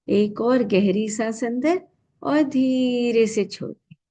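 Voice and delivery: slow, stretched words with a held tone; guided breath cue. Real, not acted. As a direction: pace a long breath in and out. (सांस के साथ धीमी, खिंची हुई आवाज़)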